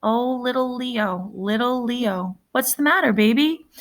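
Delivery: tender big brother, slowed and hushed. A narrator's reading. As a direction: soothe the scared boy gently. Comforting scared little brother.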